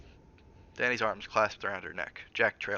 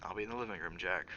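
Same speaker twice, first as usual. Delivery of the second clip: sighing; 6 dB quieter than usual. Acted. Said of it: "Wendy sighed".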